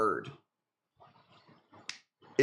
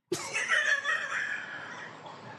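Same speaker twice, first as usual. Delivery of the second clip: high shrieking laugh; 4 dB quieter than usual. Real, not acted. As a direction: cackle high at your own line. Laughs at own joke.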